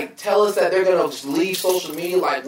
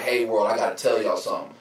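mocking someone announcing a break; mock depressed announcement; 8 semitones lower than usual. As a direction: sigh it out like a fake sad farewell. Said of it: "it's like".

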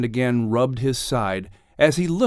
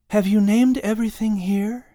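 Eve's voice; 9 semitones higher than usual. A narrator's reading. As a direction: ask in wonder, eyes wide. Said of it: "Eve asked".

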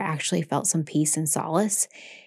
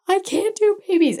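much higher, louder, voice cracking in distress; distraught cry; 13 semitones higher, 3 dB louder than usual. Retold or imitated. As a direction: blurt it out as if about to break down. Nurse retelling breaking point at work.

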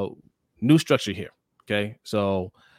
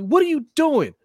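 high, loud retold yell; yelling at the screen; 6 dB louder than usual. Fan yelling at the TV during the game, high and loud. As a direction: yell at the TV like the game's slipping away. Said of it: "I'm yelling at the screen".

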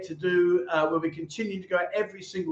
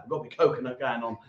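much lower and softer, a mock glum "I'm miserable"; 7 semitones lower, 5 dB quieter, faster than usual. Host answering as the viewer Miserable Coconut, mock glum and low. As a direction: drop it down into a hammed-up sulk.

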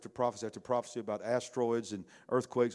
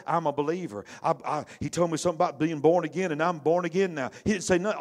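higher and louder mock testimony, a bit fast; 6 semitones higher, 8 dB louder than usual. Preacher mocking the testimony Nicodemus never gave, loud and high. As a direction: ham up a loud convert's boast.

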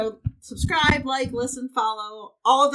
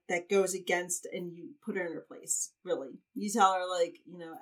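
normal talking voice

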